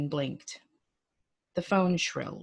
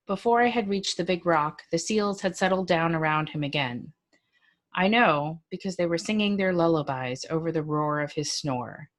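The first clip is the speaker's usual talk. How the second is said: ordinary delivery, nothing marked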